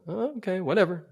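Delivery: high-pitched "oh, okay, whatever"; grudging acceptance. Retold or imitated. Grudgingly gives in to the voice.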